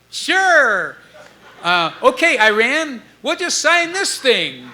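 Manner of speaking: high, mock naive, slow at the end; naive pushover. Mocking a naive young band agreeing to sign, high. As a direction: play the gullible kid who signs anything. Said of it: "You don't just go".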